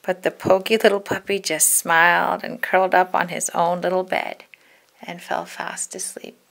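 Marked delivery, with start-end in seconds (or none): 5.0-6.4 softly whispered ending; soft whispered story end; sleepy whisper. A narrator's reading.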